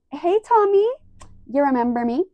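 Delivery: high-pitched, loud mock-sweet greeting; crazy stalker. Vlogger mimicking Miami girl greeting Tom, crazy stalker voice. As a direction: imitate an overeager stalker turning up uninvited. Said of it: "like, a crazy stalker".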